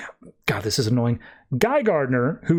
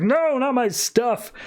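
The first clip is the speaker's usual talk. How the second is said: slow, much higher wail over his treasure; anguished miser wail; 9 semitones higher, slower than usual. Retold or imitated. Larfleeze hearing of the theft, a slow high wail. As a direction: wail it like a child losing toys.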